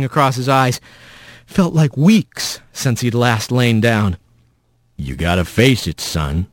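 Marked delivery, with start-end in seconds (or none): 5.0-6.5 deep, fatherly rumble; rumbling. A narrator's reading. Mysterious voice above fallen Rat, low fatherly rumble. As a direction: rumble it low, trailing softer. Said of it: "that voice rumbled above him".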